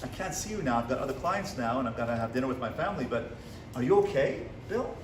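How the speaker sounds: soft, tender concern for Bill; quiet, kind and reassuring; gentle and caring. Pretend. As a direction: speak softly as if comforting a late client. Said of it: "if I had love in me".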